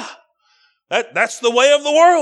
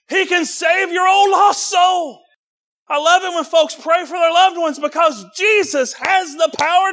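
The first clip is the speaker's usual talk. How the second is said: preaching at full force; 6 semitones higher than usual. Acted. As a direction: lift the congregation with a rising, shouting preach.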